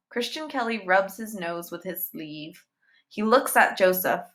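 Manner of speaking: ordinary delivery, nothing marked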